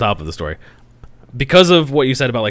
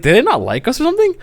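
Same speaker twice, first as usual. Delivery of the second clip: high incredulous quote; 11 semitones higher, faster than usual. Retold or imitated. Retold outrage at waitress.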